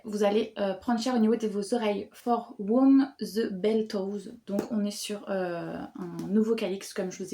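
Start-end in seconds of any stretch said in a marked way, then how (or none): 2.1-4.3 slow, careful English title with a French accent. Real, not acted. French booktuber reading an English title, slow French accent. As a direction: read "For Whom the Bell Tolls" slowly in French-accented English. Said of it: "Oh là là, mon accent en anglais".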